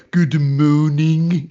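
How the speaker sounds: slow, drawn-out mock French accent; quoting the old sitcom policeman in a bad French accent. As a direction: stretch the vowels like a fake Frenchman. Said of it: "I used to say things like".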